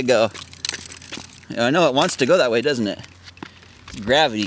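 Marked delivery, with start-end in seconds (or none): none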